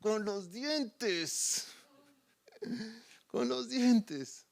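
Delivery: José hanging by his teeth, answering slowly and strained; through clenched teeth. Retold or imitated. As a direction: squeeze it out as if biting a rope. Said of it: "le responde".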